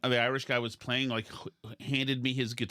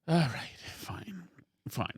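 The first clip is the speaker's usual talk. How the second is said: grudging whisper; 2 semitones higher, slower than usual. Pretend.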